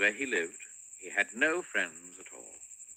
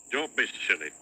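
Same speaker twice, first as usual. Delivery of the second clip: snorting; 6 dB louder than usual. Acted. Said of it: "snorted Mister Uppity".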